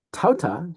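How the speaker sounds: Tauta with a rising-falling circumflex tone. Shown as a demonstration. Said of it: "Here's your circumflex".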